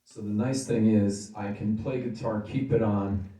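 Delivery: ordinary talk, nothing marked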